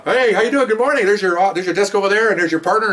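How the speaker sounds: high, lively greeting; upbeat welcome. Retold or imitated. Boss showing a new narcotics officer to her desk, upbeat. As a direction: imitate a bright, bustling morning hello. Greeting excited newcomer to the unit.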